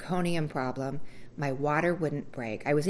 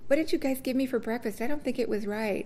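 much higher, mock-whiny voice; whiny complaint; 9 semitones higher than usual. To the nurses about her stomach, in a high whiny voice. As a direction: play her younger self fussing about breakfast. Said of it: "I was like".

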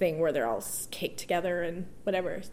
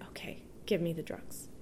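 whispered, much softer surrender; defeated whisper; 3 semitones lower, 10 dB quieter than usual. Retold or imitated. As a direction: breathe it out like giving up. Giving in, asking for epidural.

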